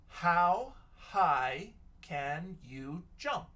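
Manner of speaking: slow dictation. Shown as a demonstration. Teacher reads her question while writing, slow dictation. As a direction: read it very slowly, rising on the last word.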